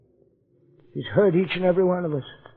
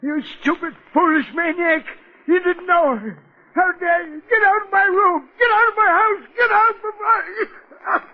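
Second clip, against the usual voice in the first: building fury, voice climbing much higher until it breaks into a shout; furious outburst; 10 semitones higher than usual. Acted. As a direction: lash out at the intruder, rising to a scream of get out. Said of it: "I didn't mean to upset you".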